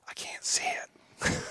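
slow imitated whisper; slow whispered complaint; retold whisper. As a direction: hush it so the elk won't hear. Wife whispering while elk listens close.

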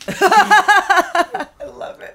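laughing. Real, not acted. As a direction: burst out laughing as you speak. Laughing at the meme song.

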